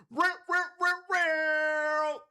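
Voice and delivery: chanted real real like a sound effect; mock sound effect. Shown as a demonstration. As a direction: voice the crowd sound yourself. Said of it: "I'll do the, the sounds".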